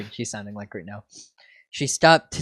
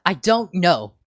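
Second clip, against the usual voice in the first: snapping; 4 semitones higher, 8 dB louder than usual. Acted. Flustered snapping denial.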